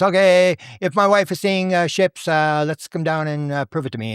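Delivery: voicing a doubter's bargain; acted challenge. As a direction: call up to the sky, half-daring. Retelling man challenging UFO to prove it.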